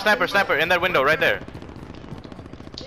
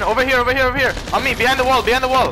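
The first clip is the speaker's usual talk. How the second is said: raised urgent callout; combat callout; 7 semitones higher, 4 dB louder, faster than usual. Real, not acted. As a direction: call your squad over fast. Calling team to position mid-fight.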